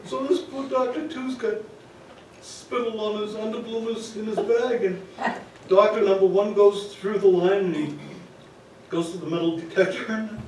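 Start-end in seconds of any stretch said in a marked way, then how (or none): none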